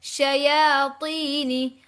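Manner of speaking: शयातीनी slow, with या stretched; measured, drawn-out शयातीनी; lengthened ya. Shown as a demonstration. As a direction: hold the या of शयातीनी before a long ती. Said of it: "या को लंबा करना है".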